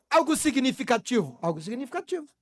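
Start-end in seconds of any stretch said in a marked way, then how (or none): 1.4-2.3 dropped down softer, letting it sink in; a hushed echo of the phrase; softer repeat. Shown as a demonstration. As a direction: say it again, much softer.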